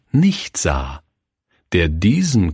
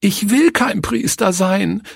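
put-on high, tearful cry of a small girl; little girl wailing at the pastor; sobbing girl; 12 semitones higher than usual. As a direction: cry out, near tears. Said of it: "rief sie schluchzend".

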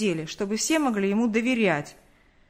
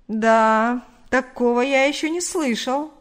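amused disbelief; 2 semitones higher, 5 dB louder, slower than usual. Acted. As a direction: exclaim it as if it is absurd. Investigator scoffs at the odd request.

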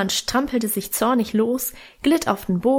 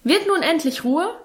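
irritated father, voice pitched up; scolding father; 7 semitones higher than usual. A narrator's reading. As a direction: scold the children sharply. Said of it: "schalt Vater in erhöhter Lautstärke".

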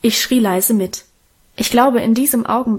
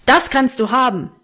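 in höchstem Zorn; 3 semitones higher than usual. A narrator's reading. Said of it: "erwiderte Vater in höchstem Zorn".

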